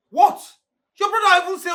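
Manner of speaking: high, loud disbelieving outburst; exasperated outburst. Acted. Driver confronting his boss, loud and high. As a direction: throw the question back at her, loud and high.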